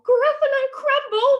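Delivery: very high, loud panicked shriek; terrified Gruffalo. Acted. Gruffalo echoes mouse before fleeing, terrified. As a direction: squawk it quickly in horror.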